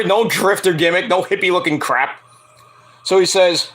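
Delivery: ordinary delivery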